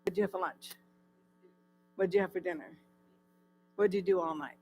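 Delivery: normal talking voice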